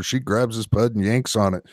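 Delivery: ordinary talk, nothing marked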